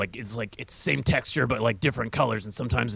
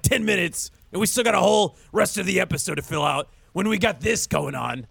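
ordinary talk

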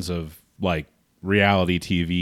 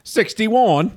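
announcer; 13 semitones higher, 3 dB louder than usual. Acted. Joking fight count.

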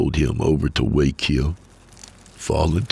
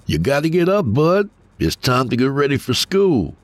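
put-on higher, coaxing father's voice rousing a sleepy boy; gentle wake-up call; 11 semitones higher than usual. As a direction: nudge a kid out of bed with a lifted voice. Father gently wakes his son.

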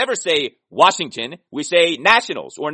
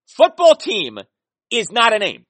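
higher and louder, hammering the point; frustrated fan shouting his case; exasperated outburst; 6 semitones higher, 6 dB louder than usual. Real, not acted. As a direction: insist the name is no name at all. Frustrated rant about team name.

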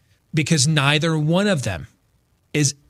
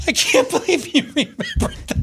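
high, laughing disbelief; giggling amazement at a colleague; 12 semitones higher than usual. Real, not acted. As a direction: laugh it out in delighted surprise. Laughing at cohost's answer.

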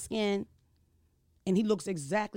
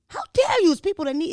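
shrieking, much higher mock outrage; 10 semitones higher, 8 dB louder than usual. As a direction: play it hammed-up and indignant.

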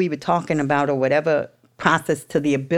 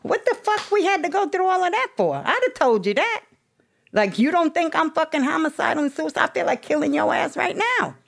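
high, pitched-up incredulous rant; exasperated mock rant; 13 semitones higher than usual. To the evaluator about her tests, high exasperated rant. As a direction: scoff at the pointless tests, voice way up.

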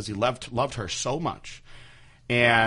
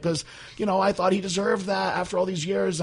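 higher mock mom voice; imitating mom; 10 semitones higher than usual. Mom justifying to son, high mock voice. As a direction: pitch up like mom. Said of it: "And she was like".

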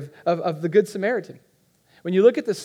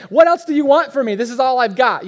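higher, louder exasperated husband; 6 semitones higher, 8 dB louder than usual. Retold or imitated. Husband pushed for details by his wife, mock exasperated outburst. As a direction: throw up your hands in mock frustration. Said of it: "It's like".

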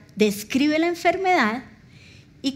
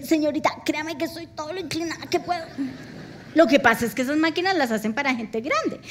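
fast retort; 2 semitones higher, faster than usual. Retold or imitated. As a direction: rattle it off as an excuse. Patient's retort retold.

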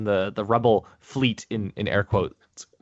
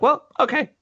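high-pitched, louder imitated reply; Ezra's breezy retort; 6 dB louder than usual. Ezra answering the commander's taunt, mock agreeable. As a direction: toss it back in a raised voice. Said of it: "that's when Ezra's like".

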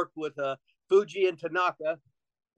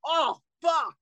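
pained yelp; 9 semitones higher than usual. Retold or imitated. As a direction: yelp it like a hard punch just landed. Retelling being hit hard in ring.

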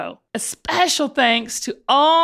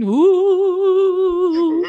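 high, eerie ghostly moan; 6 semitones higher than usual. Acted. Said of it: "I do a good ghost".

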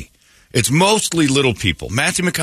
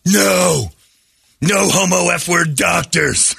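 higher, slow hammed-up yell; imitating a ridiculous shouted line; mock shouted refusal; 6 semitones higher, much slower than usual. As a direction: bellow it like the bad movie line. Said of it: "he goes".

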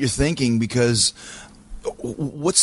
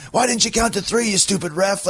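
higher, mock-angry yell at the ref; hammed-up frustration, pitched up; imagined angry yell at ref; 7 semitones higher than usual. Wrestler imagines yelling at the referee, mock angry. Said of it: "I should have been saying".

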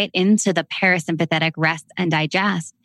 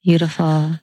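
guided meditation. Acted. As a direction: lead them gently into the breath. Leads a breathwork meditation.

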